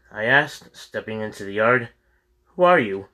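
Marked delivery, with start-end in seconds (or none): 2.6-3.1 rough, hoarse voice asking sharply; hoarse young man. A narrator's reading. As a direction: rasp it out like a wary young farmer. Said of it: "a young man asked in a hoarse voice".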